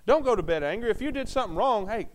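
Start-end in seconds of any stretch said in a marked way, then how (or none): none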